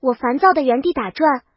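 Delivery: ordinary delivery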